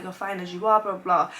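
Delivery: ordinary talk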